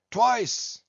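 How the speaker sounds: high, brisk one-word captain's answer; hearty one-armed captain. A narrator's reading.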